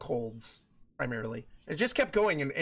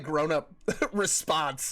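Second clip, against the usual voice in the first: ordinary talk, nothing marked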